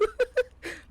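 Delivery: high squealing giggle; shrill burst of laughter; laughing. Real, not acted. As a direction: crack up helplessly. Laughing at his own slip.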